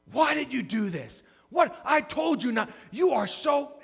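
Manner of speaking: berating. Retold or imitated. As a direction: lay into her, reenacting a furious scolding. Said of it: "I start berating her".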